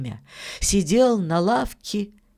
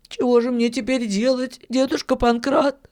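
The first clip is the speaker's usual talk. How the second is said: put-on high-pitched boy's voice; small high plea for advice; Filka, pleading boy; 7 semitones higher than usual. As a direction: ask the old miller helplessly, voice pitched up. Tearful boy asks the miller.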